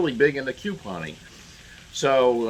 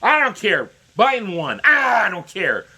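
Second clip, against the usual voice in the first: mock media tantrum; 8 semitones higher, 5 dB louder than usual. Imitating the media ignoring the proof, yelling. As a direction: parody a stubborn tantrum. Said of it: "same attitude as the media".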